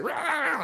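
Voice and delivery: high, restrained snarl-yelp; imitated dog freak-out. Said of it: "she goes like".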